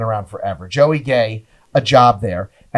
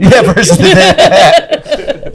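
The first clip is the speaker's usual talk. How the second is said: slow, laughing through it; drawn out through laughter; 9 semitones higher, 7 dB louder, much slower than usual. Real, not acted. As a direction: stretch it out while cracking up. Laughing through mock court.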